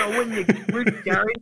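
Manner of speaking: high, excited mock rant; hyped-up Duck. Duck tells Gary about the fridge, hyped-up rant. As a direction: rattle it off high and fired up. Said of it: "Duck opens the door and says".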